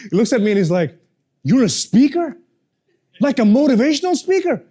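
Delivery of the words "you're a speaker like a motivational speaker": jolly cab driver. Retold or imitated. Cab driver quoted in story.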